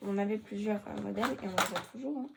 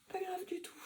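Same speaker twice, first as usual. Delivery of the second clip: soft breathy whisper; whispered aside; 8 semitones higher, 7 dB quieter than usual. Real, not acted. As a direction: whisper it to yourself. Aparté chuchoté sur sa coiffure. (petit aparté chuchoté)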